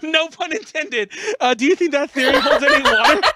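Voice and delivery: words broken by laughter; giggling through the words; laughing. Real, not acted. Laughs at own pun.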